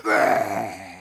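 deep rumbling growl. Acted. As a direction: growl like a menacing giant. Big men growling.

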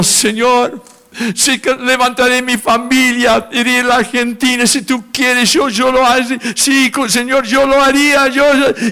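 tearful prayer. Retold or imitated.

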